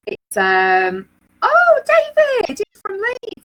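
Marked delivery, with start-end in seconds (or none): 1.4-3.4 light up at a hometown name; host spotting a viewer from her own town, delighted; surprised delight. Real, not acted. Surprised at fellow Leeds viewer.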